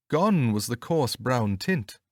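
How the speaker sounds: ordinary delivery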